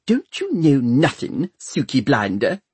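high, reproving servant voice; scolding housekeeper. A narrator's reading. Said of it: "whispered back".

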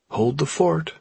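high, lightly tipsy sign-off; breezy, mildly drunk goodbye; a hint of drunken cheer; Craig drunk. A narrator's reading. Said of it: "He was drunk for sure".

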